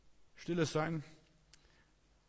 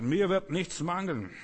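slow, loud, higher proclamation; solemn psalm recitation; 6 semitones higher, 5 dB louder, slower than usual. Retold or imitated. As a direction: declaim the psalm slowly with force. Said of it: "durch die Wohnung auf und ab marschiert". (langsam, laut und feierlich ausgerufen)

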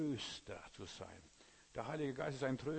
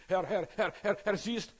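high, loud frantic cries of Herr; a believer in panic calling out to God; panicked prayer; 8 semitones higher, 9 dB louder, slower than usual. Acted. Believer panicking in prayer, high frantic loud cries.

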